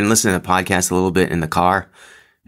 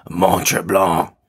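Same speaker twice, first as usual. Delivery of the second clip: slow, drawn-out French Canadian pronunciation of Mont Tremblant; playful French Canadian accent on the place name; much slower than usual. Acted. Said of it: "that's my French accent".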